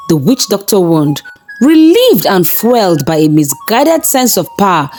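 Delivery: plain reading voice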